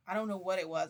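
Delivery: ordinary talk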